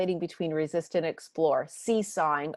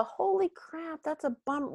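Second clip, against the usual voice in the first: put-on dismayed kid; 9 semitones higher, 4 dB quieter than usual. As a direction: groan it out like a kid hit with chores. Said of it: "if they have a".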